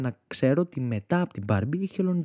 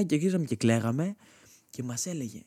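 normal talking voice

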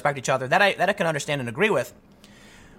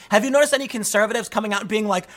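ordinary delivery

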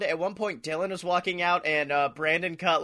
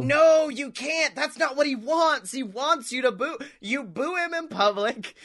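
exasperated protest; 7 semitones higher than usual. Acted. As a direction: protest to the fans in a raised, stretched-out voice. Pleading with fans to boo.

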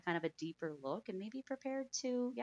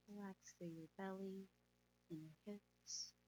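soft spa whisper; 8 dB quieter than usual. Acted. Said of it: "let's go ahead and meditate".

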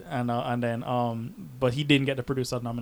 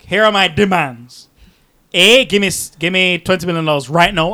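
mock demanding; 4 semitones higher, 11 dB louder than usual. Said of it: "He's like".

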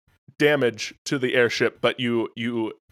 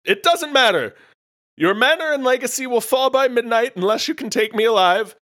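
high, raised villain proclamation; Gourd Lord; 11 semitones higher, 4 dB louder than usual. Acted. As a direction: pronounce the doom in a high, theatrical shout. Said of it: "I'm the Gourd Lord".

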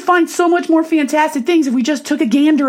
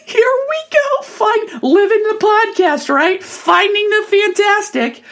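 excited; 9 semitones higher, slower than usual. Retold or imitated. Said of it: "And I was like".

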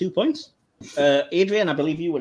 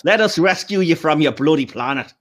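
mock Irish accent, a bit louder; bad Irish accent; 3 semitones higher, 6 dB louder than usual. Joking as O'Brien to the Romulans, mock Irish accent. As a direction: put on a hammy Irish brogue. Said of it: "is that an Irish accent?".

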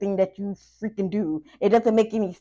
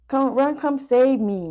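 higher, pushed plea for rescue; mock pleading ex; 6 semitones higher, 4 dB louder than usual. Reader voicing the ex begging to be saved, mock pleading. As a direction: beg like the stuck ex, half joking.

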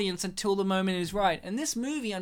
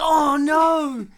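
loud, higher cry of "oh no"; hammed-up horrified sympathy; mock dismay; 6 semitones higher, 8 dB louder than usual. Bystander hears his arm crack, mock dismay.

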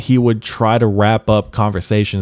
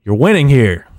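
louder taunt; 5 semitones higher, 7 dB louder than usual. Real, not acted. Taunting critics who want his downfall.

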